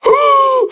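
very high bawling "ooh"; imitated bawling. Telling how he bawled, high mock wail. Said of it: "I'm bawling".

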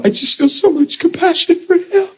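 high-pitched mock crying voice; imitating bawling. Preacher mimics the crying man explaining his compassion, mock bawling. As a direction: wail it out as an exaggerated sobbing man. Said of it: "starts bawling uncontrollably".

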